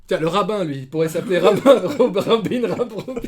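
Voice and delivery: high, laughing through the words; real laughter breaking up speech; cracking up. Real, not acted. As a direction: stumble over the name while giggling. Cracks up at rabbi wordplay.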